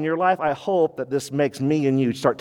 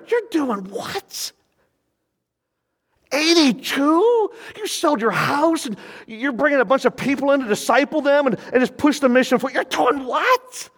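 amazed disbelief; 9 semitones higher than usual. Retold or imitated. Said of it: "that's what I'm saying to my spirit".

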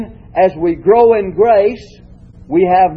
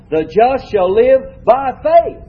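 slow line building to "faith", raised high with a rise; stress on FAITH; 7 semitones higher, slower than usual. Shown as a demonstration. Teacher showing the class the third reading, stress on "faith". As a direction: save the weight for "faith", lift it up. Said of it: "each time the emphasis is upon a different word".

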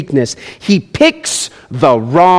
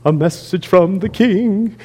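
mock herald; 5 dB quieter than usual. Herald announcement.

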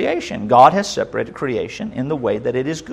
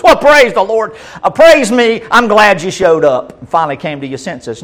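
sarcastic, higher and louder praise; mock God voice; 6 semitones higher, 6 dB louder than usual. Preacher voicing a sarcastic God, loud and high. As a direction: ham up a smug self-praising God.

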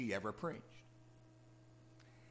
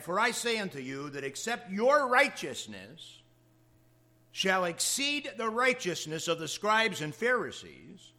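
solemn scripture reading; 4 semitones higher, 5 dB louder than usual. Acted. Reading Jesus' words from scripture.

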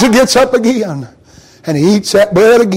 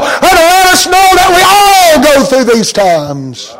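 full-force preaching; 9 semitones higher than usual. Acted. Preacher warning the church about feeling alone, shouting. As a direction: drive the point home to the church.

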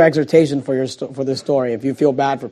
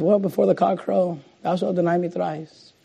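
soft, unruffled answer; hushed, measured prediction; quiet calm reply; 8 dB quieter than usual. Acted. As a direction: answer the boast softly and knowingly. Voices Jesus answering Peter's boast.

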